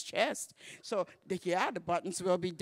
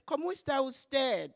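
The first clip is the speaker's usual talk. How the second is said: higher, louder, respectful ¿Cómo está usted?; respectful greeting to elder; 6 semitones higher, 4 dB louder than usual. Pretend. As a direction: greet the church mother with respect. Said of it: "we have the mother of the church".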